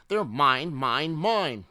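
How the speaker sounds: insistent, high-pitched hoarding; possessive outburst. A narrator's reading. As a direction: claim it all like a grabby child. Greedy dinosaur grabs the snacks.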